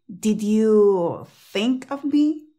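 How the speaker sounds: slow question, the D in 'Did' kept; D pronounced. Shown as a demonstration. Teacher modelling a practice question slowly, D pronounced. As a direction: draw it out, closing 'Did' on its D. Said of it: "sobre todo hazlo en contraste".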